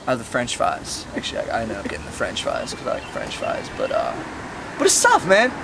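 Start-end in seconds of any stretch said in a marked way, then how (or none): none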